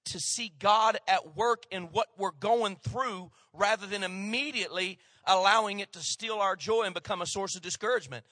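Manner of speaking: ordinary talk, nothing marked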